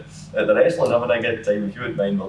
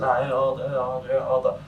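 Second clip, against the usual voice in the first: higher, pushy call for order; a girlfriend on the train shouting for order, higher and bossy; 6 semitones higher than usual. Retold or imitated. Girlfriend breaking up a train fight, higher bossy calls.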